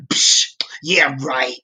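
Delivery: whispered sneer, psh yeah right; scoffing whisper. Pretend. Preacher mimicking a scoffer dismissing faith, in a whisper.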